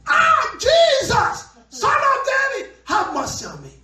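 high, loud desperate cry; shouting. Acted. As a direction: yell it like a loudspeaker. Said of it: "He became a loudspeaker".

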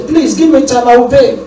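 high pleading voice; begging debtor. Retold or imitated.

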